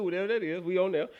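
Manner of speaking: ordinary delivery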